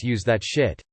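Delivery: plain reading voice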